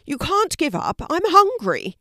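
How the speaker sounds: high, loud hungry plea; insistent urging. Acted. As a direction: urge her on loudly, high-pitched. Hungry panda urges Kelty on.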